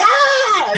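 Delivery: imitating a woman's shriek, high; high laughing mock scream. Retelling the startled subway lady's scream, high mock shriek. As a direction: squeal it through a laugh. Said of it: "that one lady's screams".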